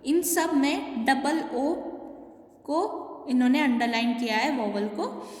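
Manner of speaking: usual speaking voice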